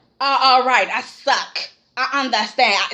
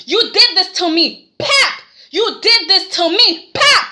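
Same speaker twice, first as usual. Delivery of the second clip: high, slow, punched-out rage, building higher; hammed-up fury; 7 semitones higher, 4 dB louder, slower than usual. Imitating a mother demanding her child back while slapping, hammed-up fury. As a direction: pound out each word, pitch climbing to mock slaps. Acts Katie's furious slapping tirade.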